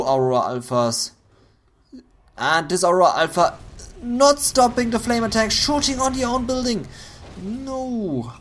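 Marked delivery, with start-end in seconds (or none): 2.4-8.3 rising excited shout. Real, not acted. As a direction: caster erupting over the play. Caster reacting to a big play.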